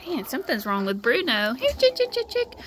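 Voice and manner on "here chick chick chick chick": high sing-song call to chickens. Real, not acted. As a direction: call the hens over, high. Calling her chickens over.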